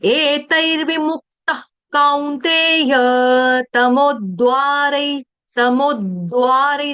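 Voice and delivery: तमोद्वारै with a stressed doubled द, slowly. Shown as a demonstration. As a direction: press the द in तमोद्वारै. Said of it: "double द ले रही हूँ". (तमोद्वारै, दोहरा द)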